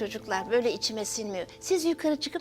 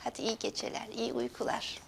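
hushed; 12 semitones lower, 10 dB quieter than usual. Acted. Goodnight in a dark room.

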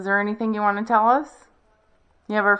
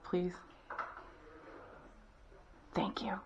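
whispered; 21 dB quieter than usual. Real, not acted. Asks someone to turn light off.

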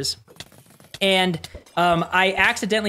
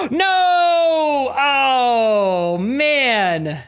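dismay shout; 8 semitones higher, 5 dB louder, much slower than usual. Real, not acted. Near death in boss fight.

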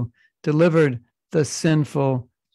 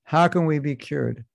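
usual speaking voice

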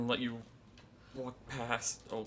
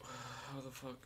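usual speaking voice

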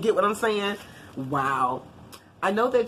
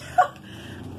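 delighted giggle. Real, not acted. Laughing with delight.

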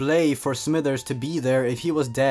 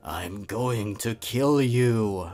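mock menace; 5 semitones lower, 3 dB quieter, much slower than usual. Dying man's threat read ominous.

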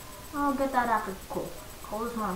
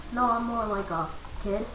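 usual speaking voice

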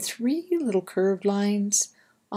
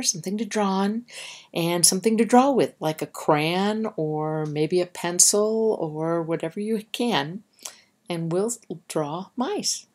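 plain reading voice

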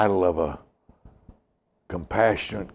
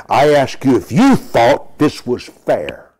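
slow, loud, hammered-out rebuke; insistent confrontation; 5 semitones higher, 10 dB louder, slower than usual. Retold or imitated. Preacher retelling how he confronted a man over an offer, loudly insistent. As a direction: press the question loudly, word by word. Retold confrontation, repeated demand.

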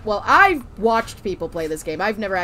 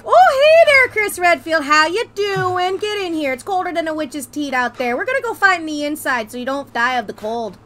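Minnesota villain; 3 semitones higher than usual. Pretend. Villain welcoming Chris Redfield in Minnesota accent. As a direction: greet the hero like a friendly Midwest neighbor. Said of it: "Just a villain just being like".

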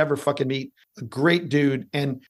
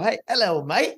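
put-on cheery British accent sliding into Australian; mock British-Australian accent; 4 semitones higher than usual. Co-host overdoing a British impression, slips into mock Australian accent. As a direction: put on a bad British accent that drifts Down Under. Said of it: "Australian, but that's another story".

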